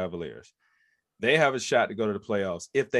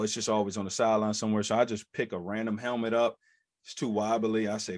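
ordinary talk

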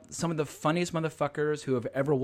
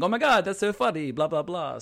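higher-pitched gush, then tossed-off blahs; mock gushing fan; 4 semitones higher than usual. Host promising to stick to praise, mock gushing. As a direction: pretend to gush like an easily amused fan. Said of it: "I'll just, uh, stick to saying".

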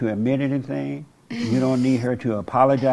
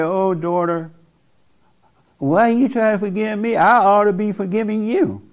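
higher imitated pleading mother; mother's voice; 8 semitones higher than usual. Counselor voicing the mother's guilt-trip, higher and pleading. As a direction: pitch it up as the mother begging. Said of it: "And if she said".

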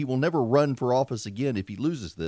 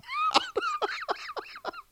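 real high-pitched laugh; 5 dB quieter than usual. Real, not acted. Host joking about guts showing on livestream, real high laugh. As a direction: burst out laughing at yourself. Laughs at his own gut.

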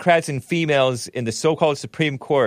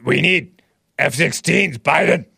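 slow mock Ukrainian accent, a bit louder; Zelensky impression; 3 semitones higher, 3 dB louder than usual. Host mocking Zelensky with a Ukrainian accent. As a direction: lampoon a Ukrainian accent begging. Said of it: "sounding more like, uh, the guy from Ukraine".

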